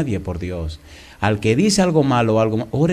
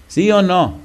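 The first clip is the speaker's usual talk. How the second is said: ordinary delivery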